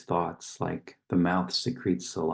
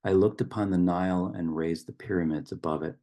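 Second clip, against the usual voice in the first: poem recitation. Acted. Reciting a river poem to open a meditation, solemn poetic delivery.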